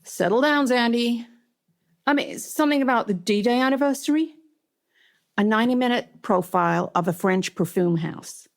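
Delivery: put-on Southern-accented boss, starting high, dropping to level; lightly acted Southern boss; Stuart. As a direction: settle someone down in a Southern accent. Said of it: "He came out very Southern".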